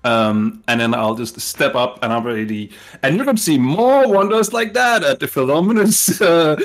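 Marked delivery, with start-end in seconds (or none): 3.0-6.6 loud, high carnival barker pitch; showman's announcement. Acted. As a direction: proclaim it grandly to the whole crowd. Showman stepping up to advertise the show.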